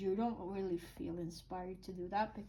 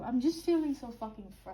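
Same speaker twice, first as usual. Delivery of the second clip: a burst of real frustration, louder; 3 semitones higher, 4 dB louder, faster than usual. Real, not acted. Tarot reader venting the reading's frustration, cut-off swear. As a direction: let the frustration slip out mid-reading. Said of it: "but I feel frustrated".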